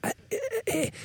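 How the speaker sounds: high, thin, airless squeaks; mocking a friend with no voice; imitating lost voice. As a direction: strain to speak with no air. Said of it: "And you're like".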